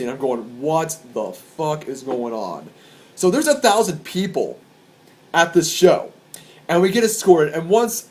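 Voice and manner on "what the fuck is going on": low, soft and slow; retold dumbfounded muttering, words spaced out; bewildered disbelief. Concertgoer told to get out, quietly dumbfounded. His confused reaction to evacuation.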